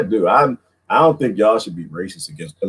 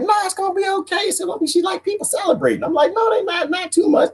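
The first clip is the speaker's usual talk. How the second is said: high mock reassurance, far from own voice; reassuring quote. Reassuring a worried friend in a high put-on voice. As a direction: soothe her in a high put-on voice. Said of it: "And I was like".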